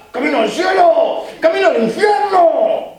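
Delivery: high, loud shouted threat; samurai battle cry. Acted. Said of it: "les decía". (grito amenazante y agudo del samurái)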